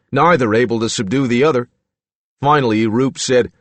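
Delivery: ordinary delivery, nothing marked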